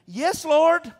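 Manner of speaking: high, loud fervent answer; fervent yes lord. Pretend. Woman answering Jesus, loud and fervent. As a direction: cry it out in full surrender.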